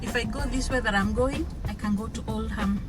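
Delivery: ordinary talk, nothing marked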